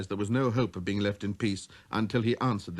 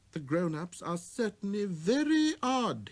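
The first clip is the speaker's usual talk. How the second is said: put-on higher, slow puzzled child; wondering aloud at adults; little prince; 10 semitones higher than usual. Prince muses.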